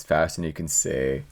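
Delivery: normal talking voice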